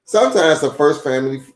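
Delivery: steady, clear read-aloud voice; reading to children. A narrator's reading. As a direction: read it like a picture book for kids. Reads a children's book aloud.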